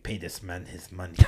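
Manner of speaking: lightly voiced movie mobster; movie Rounders villain. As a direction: murmur it like the Russian from Rounders. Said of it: "right from the movie Rounders".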